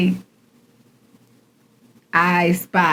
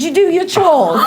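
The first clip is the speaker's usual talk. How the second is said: laughing, higher-pitched mock Manchester accent; 7 semitones higher than usual. Wife laughingly denying her accent, hamming up a Manchester accent. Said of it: "that Manchester accent is kicking".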